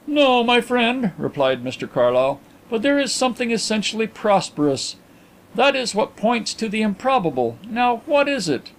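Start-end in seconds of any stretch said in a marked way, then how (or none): 0.1-1.1 higher-pitched genial Englishman; reply to a friend in a raised voice; lightly voiced gentleman. Narrator voices Carlyle answering Carrados. 2.7-8.7 higher-pitched genial Englishman; reply to a friend in a raised voice; lightly voiced gentleman. Narrator voices Carlyle answering Carrados.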